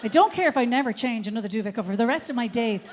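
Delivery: ordinary talk, nothing marked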